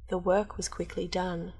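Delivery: plain reading voice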